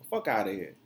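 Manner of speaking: ordinary talk, nothing marked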